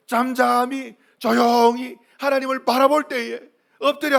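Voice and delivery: high, loud declamation with stretched key words; full-force preaching. Acted. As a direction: preach it with rising fervor.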